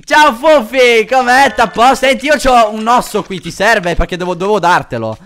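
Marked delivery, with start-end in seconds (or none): 0.0-4.0 loud, high, slow doggy talk. Real, not acted. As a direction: coo at the dog loudly. Talking to a game dog.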